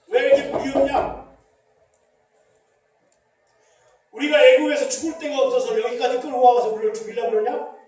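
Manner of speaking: loud accusing gripe; angry complaint. Acted. Preacher voicing Israelites accusing Moses of dragging them out to die, loud anger. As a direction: throw the blame at the leader.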